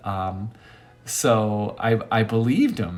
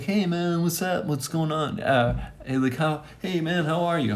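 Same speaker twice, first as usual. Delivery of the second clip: friend's greeting; 7 semitones higher than usual. Retold or imitated. Imitating friend's casual greeting.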